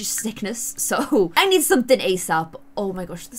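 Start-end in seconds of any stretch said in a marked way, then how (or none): none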